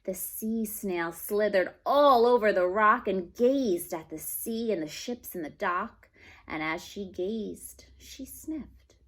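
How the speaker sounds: slow, gentle read-aloud; story reading to children. Acted. Teacher reads a picture book aloud to the class, slow and gentle. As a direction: read it slowly to little listeners.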